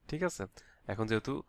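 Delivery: ordinary delivery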